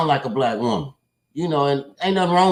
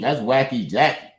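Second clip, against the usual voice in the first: ordinary talk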